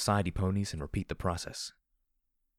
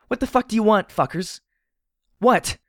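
furious high-pitched shout; screaming; 12 dB louder than usual. Acted. Heroine screams at unseen tormentors down the hallway, screaming. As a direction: scream it down a hallway at full volume. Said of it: "I screamed down the hallway".

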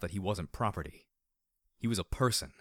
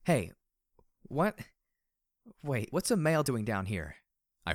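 higher, quick rising "what?"; startled what; 4 semitones higher than usual. A narrator's reading. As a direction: blurt it out in surprise. Startled reaction to a stallion below.

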